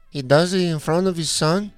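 a hint of an outraged little man; lightly voiced stranger. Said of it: "and he goes".

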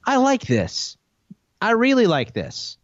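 slow, spaced claims starting high and sinking; internet meme voice. Retold or imitated. Co-host teasing the host with a meme, mock proprietary. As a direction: mimic a meme character claiming someone's work. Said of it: "You're like the internet meme".

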